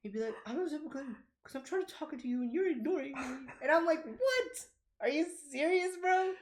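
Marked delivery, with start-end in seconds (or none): 0.5-3.5 high, whiny child imitation; mock-wounded little complaint; upset kid. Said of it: "then he got upset". 4.0-6.3 very high, squeaky disbelief; mock-appalled, voice shooting up; incredulous. Teacher stunned by kid's complaint.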